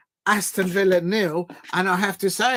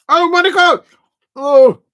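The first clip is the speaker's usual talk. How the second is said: loud, high cry at a near miss; shocked shout; 10 semitones higher, 12 dB louder than usual. Real, not acted. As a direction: shout out in alarm at the chance. Reacts to Monaco near miss.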